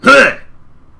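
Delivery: sudden high, very loud bark; abrupt shouted scare sound; startling loud noise. Acted. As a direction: burst out a short noise to make them jump. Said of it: "tries to make a loud noise to see if you'll jump".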